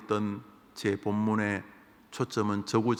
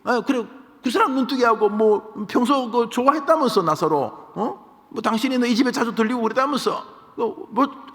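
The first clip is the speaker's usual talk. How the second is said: much higher, louder jeering voice; a scoffer taunting much higher and louder; taunting sneer; 13 semitones higher, 6 dB louder than usual. Retold or imitated. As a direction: needle him with every question. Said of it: "하고 비아냥거렸습니다".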